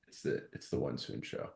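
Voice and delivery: secret hushed whisper. Real, not acted. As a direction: whisper it to insiders only. Insider name whispered.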